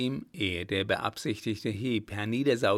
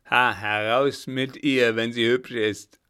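rough robber's call; 8 dB louder than usual. Acted. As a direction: push forward and bellow for her. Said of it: "rief einer".

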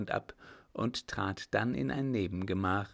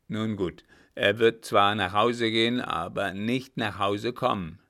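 captain's voice, firmly louder; a louder grim captain demanding a straight answer; loud stern captain; 7 dB louder than usual. Acted. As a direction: press him hard, like a gruff officer. Captain lays out his plan.

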